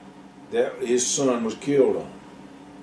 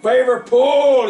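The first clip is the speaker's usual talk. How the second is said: high, loud yell; 13 semitones higher, 6 dB louder, slower than usual. A narrator's reading. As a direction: yell at the mules to pull. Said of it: "he yelled".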